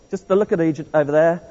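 ordinary talk

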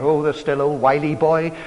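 ordinary talk, nothing marked

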